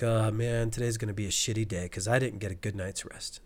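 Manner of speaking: mock-glum, low and soft; glum thought. Voicing the thought after a bad night, low mock grumble. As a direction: grumble it quickly under your breath.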